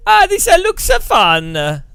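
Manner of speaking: high, loud, hammed-up Italian accent; Marco, Italian accent. Streamer mocks a drunk game designer, hammed-up Italian accent. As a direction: exuberant cartoon Italian, stretching the words out. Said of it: "Kunos design is Marco going".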